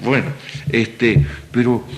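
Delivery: ordinary talk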